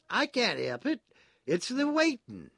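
high, loud character voice; flustered prisoner, loud and high; apologetic agitated prisoner. A narrator's reading.

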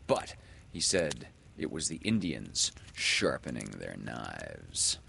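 usual speaking voice

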